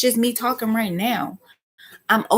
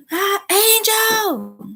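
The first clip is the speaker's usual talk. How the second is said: high, drawn-out holler of a name; loud, demanding call; 13 semitones higher, 5 dB louder, much slower than usual. Shown as a demonstration. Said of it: "not a demanding or loud voice".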